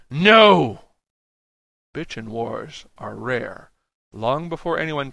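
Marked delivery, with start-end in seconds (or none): 0.1-0.8 high, very loud crowd cry; roared no. A narrator's reading. Crowd answers the rallying speaker, roaring. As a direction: roar the refusal.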